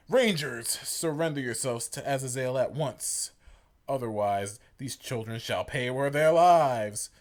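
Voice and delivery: loud villain threat, slowing down; menacing yell. Acted. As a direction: bellow the threat at the heroes. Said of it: "a familiar voice yelled towards them".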